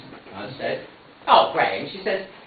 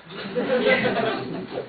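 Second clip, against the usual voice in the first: silent wheezy laugh; a breathless chuckle; breathy laugh; 5 dB quieter than usual. Real, not acted. Teller laughs.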